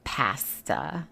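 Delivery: "pasta" slowly as PASS-tuh; deliberate short A in "pasta"; "pasta" with a drawn-out cat-like A; quoted mispronunciation. Retold or imitated. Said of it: "She says she has a right to say pasta".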